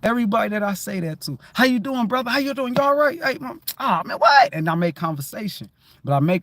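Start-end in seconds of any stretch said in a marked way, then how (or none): 1.5-4.5 high, eager hello to a stranger; chirpy re-enacted small talk; upbeat greeting. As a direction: greet them with lifted, lively pitch. Acts out friendly greeting.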